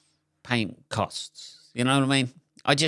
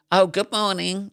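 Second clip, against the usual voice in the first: higher, sing-song greeting; mock chirpy shop greeting; 9 semitones higher than usual. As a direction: chirp it like a cashier. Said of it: "you're like".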